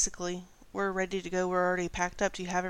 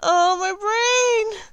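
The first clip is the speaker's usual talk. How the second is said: very high, slow, drawn-out groan; mock despair; 13 semitones higher, 11 dB louder, much slower than usual. Real, not acted. GM bemoaning her own mistake to the table, high and slow. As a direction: stretch it out like your brain just broke. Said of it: "GM groaning at her slip".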